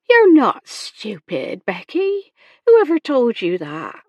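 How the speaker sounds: mildly outraged motherly reassurance; indignant housekeeper. A narrator's reading. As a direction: insist firmly, pitch swinging up. Housekeeper indignant on girl's behalf.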